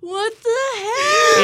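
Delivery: high, drawn-out mock yell. Moviegoer in the theater at the ending, yelling. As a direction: wail it out slowly in outrage. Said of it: "I also wanted to yell".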